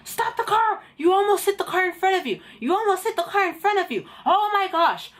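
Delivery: high, frantic examiner telling off a driver; hammed-up hysterical scolding; mock screaming. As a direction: scold in a panicky high-pitched rush. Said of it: "Why are you screaming?".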